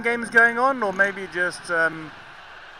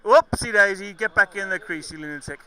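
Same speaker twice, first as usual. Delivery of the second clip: ordinary delivery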